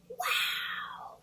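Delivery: soft breathless whisper of wonder; awed whisper. Acted. Schoolchildren whisper in awe at their new dragon classmate. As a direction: hush it like amazed kids.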